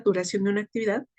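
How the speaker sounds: words rattled off fast to fix the phrase; rushed correction. Real, not acted. Teacher quickly fixing her own wording, rushed. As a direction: hurry through the corrected phrase. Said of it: "self-correction repeats phrase".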